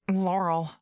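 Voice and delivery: put-on a frightened girl clinging to her friend; whimpering. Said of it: "whimpers Maya Lee".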